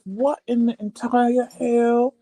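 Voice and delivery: high, slow, drawn-out shock; retold disbelief. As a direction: stretch out the startled question. Re-enacts shock at noise.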